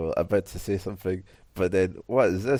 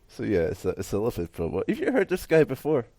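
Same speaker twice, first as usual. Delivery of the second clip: ordinary talk, nothing marked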